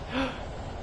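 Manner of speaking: sharp soft intake of breath; sudden gasp. Real, not acted. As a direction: gasp as it comes back to you. Remembers game glitch.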